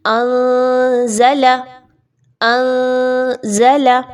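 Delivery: अंज़ला drawn out, noon hidden in mouth and nose. Shown as a demonstration. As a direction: stretch अंज़ला slowly, hiding the noon. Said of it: "मुंह में और नाक में छुपाइए". (अंज़ला नून छुपाकर, धीमे)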